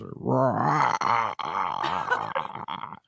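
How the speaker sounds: rasping rah ah ah, fading to breath; a grinding groan trailing off; imitated weird noise. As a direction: mimic the creepy noise again. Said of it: "And he just".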